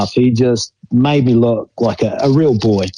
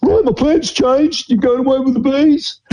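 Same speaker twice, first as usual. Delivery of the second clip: Gordy Hunter; 12 semitones higher than usual. Retold or imitated. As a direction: ham up the coach announcing the news. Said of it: "he goes".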